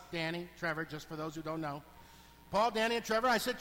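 normal talking voice

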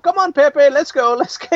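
high-pitched, giddy cheering-on of a horse; gleeful egging-on. Retold or imitated. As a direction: imitate someone merrily urging a horse along. Voicing gleeful wrestler riding Pepe.

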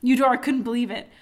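ordinary delivery, nothing marked

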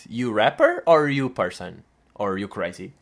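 slow mock foreign accent; mock broken English. Comedian mocking the host in mock broken English. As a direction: play a clueless English question, slow.

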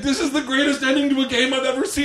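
raving about the game; high laughing rave; imitating excited Tom. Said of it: "He's like".

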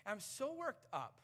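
ordinary talk, nothing marked

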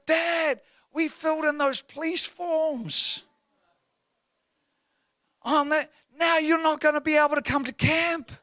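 put-on very high, wailing child voice; distraught daughter imitation. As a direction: draw it out like a devastated kid, acted. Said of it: "But she's going".

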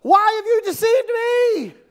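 high, loud accusing shout; crying out loudly. Acted. Woman confronting Saul who deceived her, crying out loudly. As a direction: cry out at the top of your voice. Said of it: "cried out at the top of her voice".

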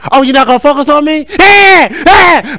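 put-on high, fast whining kid voice; whiny little boy. Pastor voicing his son Cam, high and whiny.

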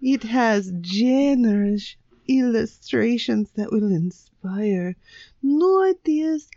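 put-on ad pitch in a dodgy Swedish accent; attempted Swedish accent. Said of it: "This is your best Swedish accent".

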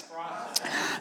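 "Process." breathed as a soft whisper. Shown as a demonstration. Pastor whispers the call word to the church, hushed. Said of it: "Somebody say".

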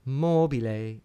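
"mobile" with MO lengthened and weighted; stress on third last syllable. Shown as a demonstration. Lecturer repeats the example word for students, first-syllable stress on "mobile". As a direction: hit the first syllable of "mobile", let the rest slide away. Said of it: "accent is on the third last syllable".

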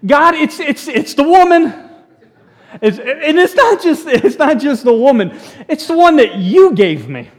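high, loud, laughing whine; hammed-up plea pointing at the woman; whiny blame-shifting excuse. Preacher mocking Adam's excuse to God, high laughing whine. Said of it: "use that excuse".

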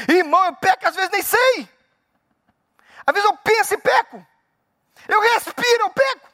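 very high, emphatic preaching; worked-up preacher's admission; impassioned confession. Real, not acted. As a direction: exclaim it high, phrase by phrase.

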